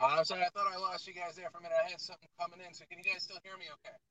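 faint, muffled, like inside a space helmet; distant voice over a bad connection; astronaut outfit sound. Real, not acted. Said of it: "You sound like you're in an astronaut outfit".

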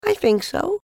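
put-on very high child's reply. A narrator's reading. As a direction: answer up to the bear, pitched high. Voices the child in story.

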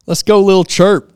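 loud, high hype shout; excited. Real, not acted. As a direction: call the name out like an emcee. Hyping the reviewer's name.

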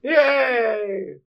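high squealed fake cheer; mock cheer.

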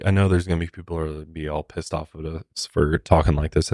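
usual speaking voice

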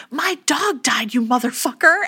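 hammed-up fury, dragging the words out; the heroine blowing up at her boss, a mock furious yell; retold furious outburst. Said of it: "she loses her mind".

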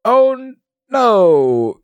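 high hammed-up dismay; overacted cry of alarm; mock dismay. Captive character reacts in dismay.

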